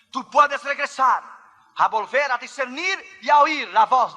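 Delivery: high, urgent pulpit exhortation; forceful preaching. Real, not acted. Preacher urging listeners back to God's will, forceful preaching.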